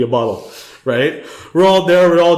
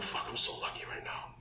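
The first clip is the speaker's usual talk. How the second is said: breathless whisper, much softer; inner thought whisper; 22 dB quieter than usual. Retold or imitated. Hiding from men with machetes, relieved whisper to himself. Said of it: "I'm like, in my head".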